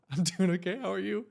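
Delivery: retold awkward giggly reply. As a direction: answer through a little laugh. Awkward reply to stoned stranger.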